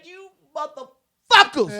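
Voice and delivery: slow, high and loud pulpit rebuke; preacher scolding. Acted. Preacher scolding his congregation, slow loud rebuke. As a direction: drag it out like a fed-up preacher. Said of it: "I need to be a preacher just to say".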